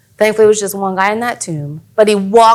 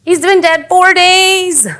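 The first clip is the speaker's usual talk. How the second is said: alarmed protest; 6 semitones higher than usual. Acted. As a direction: protest in disbelief, stretching the last words. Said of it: "and they're like".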